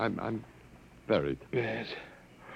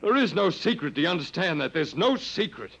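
raised, urgent plea; desperate pleading; 6 semitones higher than usual. Acted. Desperate plea to save woman.

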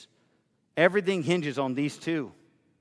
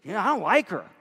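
higher, offhand snub; mock dismissive critic; 6 semitones higher, faster than usual. Imagined critic snubbing the pastor's wife, lightly mocking. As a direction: brush off the pastor's wife casually.

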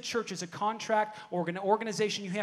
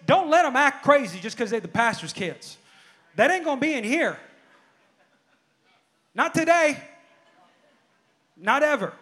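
raised, emphatic pulpit declaration; forceful preaching; 3 semitones higher, 5 dB louder than usual. Retold or imitated. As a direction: lay down the law to the staff. Preaches at full force, retelling own order.

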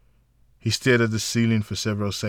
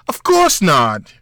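slow, higher, louder playful retort; playful scolding; 7 semitones higher, 12 dB louder, slower than usual. A narrator's reading. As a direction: swat the idea away playfully.

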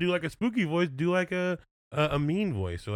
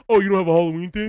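high, loud, fast mocking whine; mocking imitation; 7 semitones higher, 9 dB louder, faster than usual. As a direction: mimic the co-host in a squeaky put-on voice. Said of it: "you're like".

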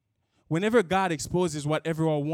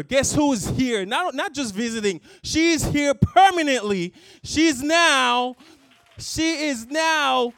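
high, loud, slow triumphant announcement; joyful shout; 7 semitones higher, 7 dB louder than usual. Real, not acted. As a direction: shout the good news to the whole room. Joyful reveal of mother's presence.

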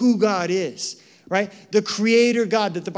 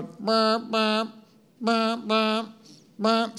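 slightly higher, even beeping bumps; busy signal imitation; 4 semitones higher, much slower than usual. Preacher mimics the busy signal he heard, steady bumps. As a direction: tap out each bump like a busy tone. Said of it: "Sounds like a busy signal".

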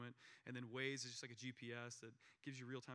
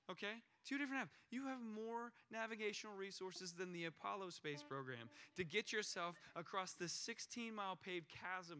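much higher, mock-smug lecture; dismissive father; 5 semitones higher than usual. Father brushing off his scared daughter, mock-smug and much higher. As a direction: play the smug dad who brags about apps. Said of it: "what kind of father would I be if my reaction was like".

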